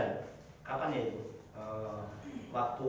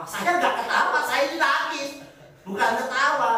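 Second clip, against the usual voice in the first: laughing protest; 6 semitones higher, 5 dB louder than usual. Real, not acted. Protesting he was crying not laughing.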